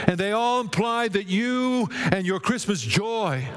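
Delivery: high-pitched, ringing sermon delivery; rousing preacher's cadence; impassioned preaching. Real, not acted. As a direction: proclaim it to the whole congregation.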